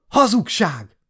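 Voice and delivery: very high, loud hysterical scream; sobbing scream. Acted. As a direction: shriek the denial through tears. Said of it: "sikoltott zokogva". (zokogva sikoltva)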